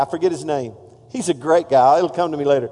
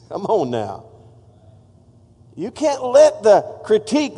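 ordinary talk, nothing marked

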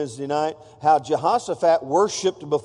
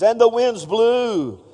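dramatic reading; 4 semitones higher, 4 dB louder, slower than usual. Shown as a demonstration. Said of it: "that's just a breeze".

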